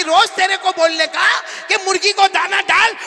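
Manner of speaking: high, loud scolding shout; scolding mother. Retold or imitated. Angry mother shouting at her son during the lesson. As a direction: scold him like a furious old mother.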